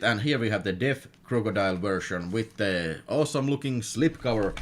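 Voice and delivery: normal talking voice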